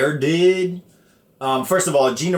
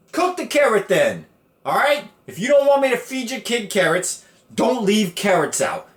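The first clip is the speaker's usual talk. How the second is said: indignant rant; 10 semitones higher than usual. Real, not acted. Defending himself to mom over the carrot, mock outrage. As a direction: protest your innocence hammed up.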